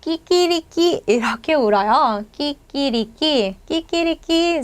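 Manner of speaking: 끼끼리끼 crowed like a Spanish rooster. Shown as a demonstration. Teacher imitating a Spanish rooster crowing 끼끼리끼. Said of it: "스페인 닭은 어떻게 우냐면요".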